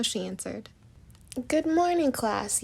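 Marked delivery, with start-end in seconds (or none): 1.5-2.6 bright high call, louder; yelling. A narrator's reading. Teacher rushing into class, high loud greeting. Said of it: "yelled Doctor Maurice".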